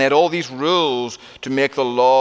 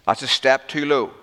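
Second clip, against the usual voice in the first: ordinary delivery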